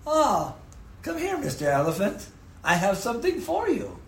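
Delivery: a hint of a sneering trainer, high; mock sly elephant trainer. Trainer luring the elephant, lightly voiced mock menace. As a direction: coax the elephant with mock sweetness. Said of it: "and says".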